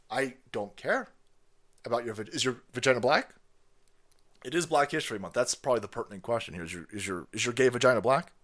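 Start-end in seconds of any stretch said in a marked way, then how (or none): none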